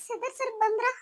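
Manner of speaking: high, tearful calling out; wife sobbing his name; crying. Acted. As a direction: call for him through tears. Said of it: "ಯಾಕೆ ಅಳ್ತಿದ್ಯಾ".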